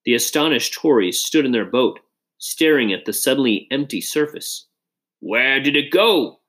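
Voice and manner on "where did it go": drawn-out, higher-pitched holler; slow, mild holler. A narrator's reading. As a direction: holler slowly in baffled astonishment. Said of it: "Mister Pratt hollered".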